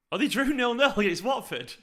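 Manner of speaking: high-pitched, gleeful surprise; delighted disbelief. Real, not acted. Fan checking rival's score, delighted disbelief. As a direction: blurt out astonishing news in a high voice. Just learns rival's embarrassing result.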